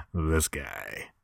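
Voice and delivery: low, quiet announcer-style punchline; deep gag voice. Acted. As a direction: drop into a low deadpan "this guy".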